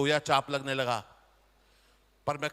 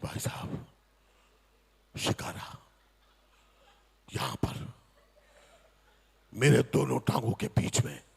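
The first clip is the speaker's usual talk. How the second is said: hushed suspense; 6 semitones lower than usual. Acted. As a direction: lean in and whisper the danger. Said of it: "tense hushed storytelling".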